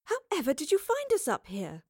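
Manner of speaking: high, astonished question; surprised woman's exclamation; gasping surprise. A narrator's reading. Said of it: "Jane gasped".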